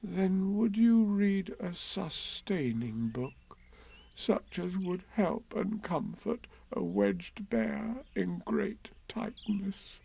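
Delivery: soft, slow, plaintive bear voice; tearful Pooh. Acted. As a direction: plead gently, close to tears. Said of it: "a tear rolled down his eye".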